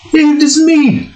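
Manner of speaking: high put-on voice for a greeting; character voice attempt. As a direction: try out a high cartoon voice. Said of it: "wait, hold on".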